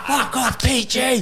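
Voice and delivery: high, cracking up through it; retold swearing lost in laughter; laughing mock outburst. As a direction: laugh while shouting him off. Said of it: "It's like".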